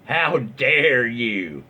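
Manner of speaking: slow, drawn-out mock affront; milk it like a scandalised mum; indignant mother. Mother outraged at the upholsterer, drawn-out mock indignation. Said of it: "Tell the mother".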